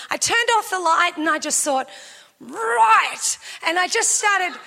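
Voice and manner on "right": determined. Retold or imitated. Resolving to pray devils out.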